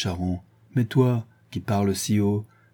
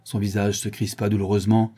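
ordinary delivery

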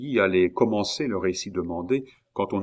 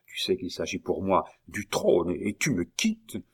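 whispering. Acted. Duke reproaches Bussy, hushed.

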